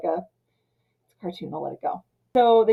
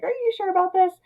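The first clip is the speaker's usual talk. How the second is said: scared chicken; 11 semitones higher, faster than usual. Retold or imitated. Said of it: "total chicken still".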